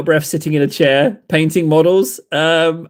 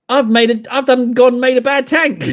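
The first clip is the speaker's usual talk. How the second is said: high, mock-anguished confession breaking into a laugh; high-pitched comic self-blame; mock outrage; 8 semitones higher, 3 dB louder than usual. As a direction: blurt it out in exaggerated dismay. Mock despair at no comments.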